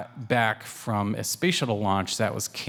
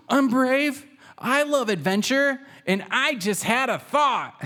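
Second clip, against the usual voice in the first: excited Waffles; 13 semitones higher than usual. A narrator's reading. Little cat announcing his big idea, high and excited. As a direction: burst out, thrilled and high.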